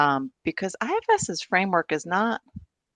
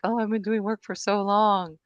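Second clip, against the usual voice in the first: higher-pitched mock-confident voice; naive self-assurance; 6 semitones higher than usual. Speaker mocking herself after years of inner work, playful complacency. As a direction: act out a self-satisfied thought, lifting into a laugh. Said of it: "you feel like".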